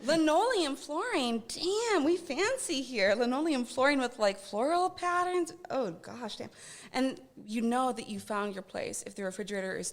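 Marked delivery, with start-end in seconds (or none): 0.0-6.5 high, loud, drawn-out mock admiration; mock impressed. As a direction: marvel as if it were pure luxury.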